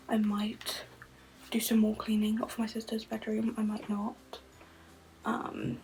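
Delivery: soft unvoiced whisper throughout. Real, not acted. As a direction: keep it hushed, barely voiced. Quiet whispered chat at home.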